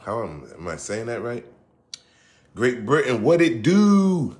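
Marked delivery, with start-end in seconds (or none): 2.5-4.4 higher, louder, slow stretched greeting; hyped shout-out. Real, not acted. Host greeting British listeners with a hyped stretched shout. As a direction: call it out big across the pond.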